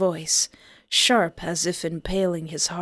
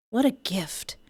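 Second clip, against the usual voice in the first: breathy whispered sarcasm; sarcastic whisper; 5 dB quieter than usual. Acted. Protagonist mocking Milo's text, in a sarcastic whisper. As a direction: whisper it with a scoff.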